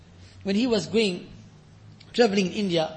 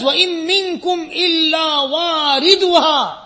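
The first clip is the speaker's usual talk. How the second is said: tearful recitation; 9 semitones higher, 9 dB louder, much faster than usual. Retold or imitated. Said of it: "he begins to sob like a little baby".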